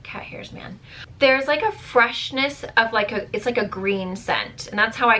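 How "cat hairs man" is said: muttered under breath, whispered; whispered aside. Real, not acted. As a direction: whisper it to yourself. Hair in her mouth.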